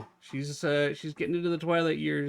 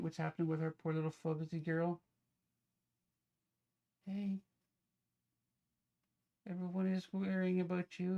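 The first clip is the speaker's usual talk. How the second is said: gentle, much softer voice; soft pet talk; 2 semitones higher, 9 dB quieter than usual. Real, not acted. Owner comforting her sick cat in a soft hushed voice.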